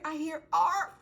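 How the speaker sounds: put-on high, loud eager child; child answering. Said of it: "What do you hear?".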